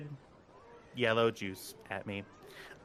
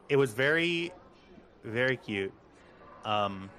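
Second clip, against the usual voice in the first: ordinary talk, nothing marked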